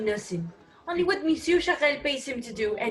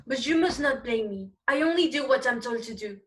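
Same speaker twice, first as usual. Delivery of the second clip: normal talking voice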